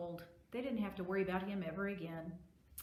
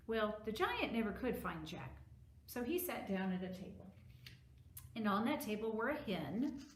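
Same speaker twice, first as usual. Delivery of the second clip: plain reading voice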